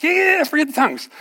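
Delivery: high-pitched, hurried dismissal; acted brush-off. Pastor brushing off a worried man's question, acted dismissal.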